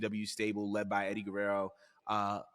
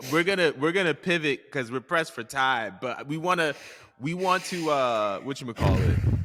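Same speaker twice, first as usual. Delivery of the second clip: laughing host; 6 semitones higher, 6 dB louder than usual. Real, not acted. Host pivots, laughing and loud.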